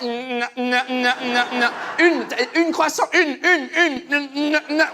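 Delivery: laughing mock baker. Baker laughing at the customer's wrong gender, mock laughter. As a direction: giggle the correction at the customer. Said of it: "elle a rigolé, elle a fait".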